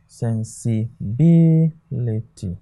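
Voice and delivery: sensibility, slow and split up, BI drawn out; stress on third syllable. Shown as a demonstration. Teacher demonstrating the last word, sensibility with long stressed BI. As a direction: spell out sensibility syllable by syllable, leaning on BI. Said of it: "their stresses are on the third syllables".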